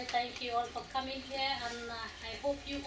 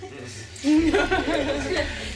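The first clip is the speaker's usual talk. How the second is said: loud laugh; 3 semitones higher, 10 dB louder than usual. Real, not acted. Laughing at consultancy fee joke.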